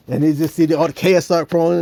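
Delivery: ordinary talk, nothing marked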